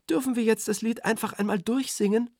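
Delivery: put-on high, impatient girl; annoyed. A narrator's reading. Girl asks Berti to let them just sing, annoyed. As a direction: roll your eyes at the grown-up.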